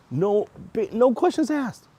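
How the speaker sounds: astonished repeat; amazed. Real, not acted. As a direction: squeak it out in disbelief. Amazed retelling the refund.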